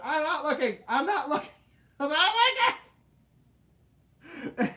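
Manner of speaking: high, loud panicked chant through laughter; a scared kid clinging on, refusing to look; panicked crying. Retold or imitated. Child on the Jaws ride, clinging on, panicked and crying. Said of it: "and I'm crying".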